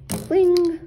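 high, loud launch cry; excited fling. Real, not acted. Showing kids the launch, a loud excited cry. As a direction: cheer it as it flies.

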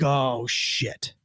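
low, soft oh shit under the breath; a touch of sinking dread, muttered. Retold or imitated. Officer called to the supervisor's office, muttering low.

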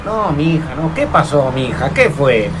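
falling, dismayed protests; appalled reaction. Acted. Friend shown an ugly baby's photo, mock-appalled dismay. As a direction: recoil in hammed-up horror.

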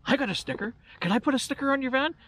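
put-on a light child's voice, quick and hopeful; eager kid voice. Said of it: "he says".

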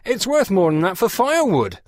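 loud, high-pitched scorn; disgusted. A narrator's reading. Claude objecting to a ten-pound offer, loudly disgusted. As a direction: throw it out in disgusted protest.

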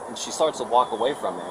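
normal talking voice

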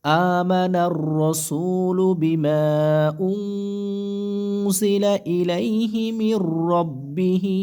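drawn-out melodic tajweed chant; slow tilawah voice, held vowels; slow Quran recitation. Shown as a demonstration. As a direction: recite each syllable long and sung. Teacher recites the verse.

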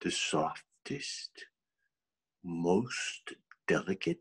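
slow, very soft and low; hushed suspense. Acted. Storyteller describing the faint sound he heard, hushed. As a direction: draw it out delicately, almost whispering.

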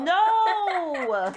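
long, high, loud refusal; exasperated yell; protesting shout. Real, not acted. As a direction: wail it out in protest. Protesting opponent calling peel.

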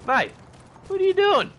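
high, loud, punchy yelling; hollering at a mate. Acted. Streamer acts ringing a friend to join stream, hollering. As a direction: shout down the phone to rope a friend in. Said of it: "just be like".

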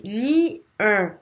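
near drawn out slowly, I sliding to E; exaggerated diphthong. Shown as a demonstration. Teacher models near slowly for students. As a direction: stretch near for the class. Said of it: "J'exagère".

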